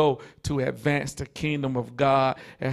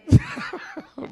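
joyful high laugh. Real, not acted. Overjoyed after the sermon.

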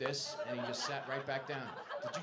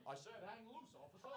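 high, softer mock protest to police; raised-pitch comic pleading; pleading drunk guy; 6 semitones higher, 13 dB quieter than usual. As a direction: imitate a guy protesting to an officer. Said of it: "you're gonna see him going".

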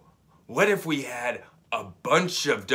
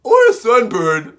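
loud, higher doctor voice; 8 semitones higher, 14 dB louder than usual. Acted. Youngest doctor guessing, loud and high. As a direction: belt out the guess.

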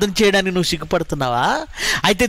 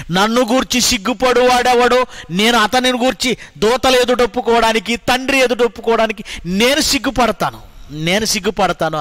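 forceful preaching; 5 semitones higher than usual. Acted. Preacher proclaiming the verse to the church. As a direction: thunder it at them. Loud scripture quote.

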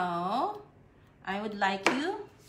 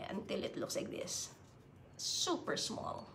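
soft whisper for something tiny; playful whisper for super small; 11 dB quieter than usual. Real, not acted. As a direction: hush it as if the paper were fragile. Showing tiny folded paper.

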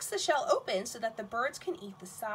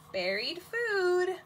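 ordinary talk

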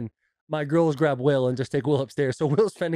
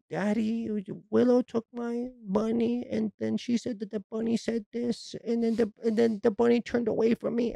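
put-on whining child; 7 semitones higher, 4 dB quieter than usual. Six-year-old tattling to dad about the bunny, whiny imitation. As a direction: whine it high and pleading, imitating a small daughter. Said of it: "the fucking whining voice".

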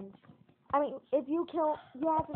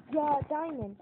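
usual speaking voice

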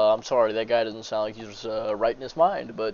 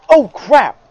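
startled; 13 semitones higher, 19 dB louder than usual. Real, not acted. Startled in horror game.

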